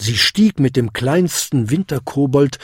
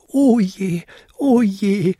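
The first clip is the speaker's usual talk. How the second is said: high-pitched little goblin wail; high, lamenting goblin voice; 9 semitones higher, slower than usual. A narrator's reading. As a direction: moan it high, in dismay. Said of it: "jammerte er".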